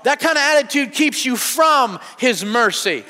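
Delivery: ordinary talk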